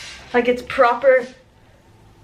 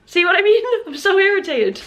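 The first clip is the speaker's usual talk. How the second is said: exasperated whine; 10 semitones higher, 3 dB louder than usual. Real, not acted. Complaining, voice pitched up.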